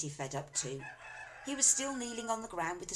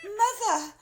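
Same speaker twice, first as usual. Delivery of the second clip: very high, loud wail; 11 dB louder than usual. A narrator's reading. Daughter wailing at her mother. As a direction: wail it in despair. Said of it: "wailed Missus Cranky".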